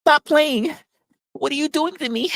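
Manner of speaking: squeaky high mock whine; high mock plea. Mocking a victim in a high whine. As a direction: plead in a high put-on voice.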